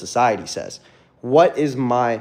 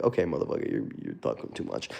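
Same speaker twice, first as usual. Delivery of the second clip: mock viewer voice; 14 semitones lower, 9 dB quieter, faster than usual. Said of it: "You're like".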